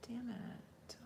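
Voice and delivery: a quick whispered damn; whispered curse. Real, not acted. Streamer whispering a curse at missing a question. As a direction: swear under the breath, unvoiced.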